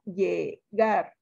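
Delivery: "llegar" in two parts, lle and GAR; syllable-by-syllable stress demo. Shown as a demonstration. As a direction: separate "llegar" so GAR is clear. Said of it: "Sílaba tónica".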